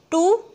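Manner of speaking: "टू" pitched high and rising; stressed syllable. Shown as a demonstration. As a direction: punch "टू" on its own. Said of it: "इसके ऊपर हमने stress दिया".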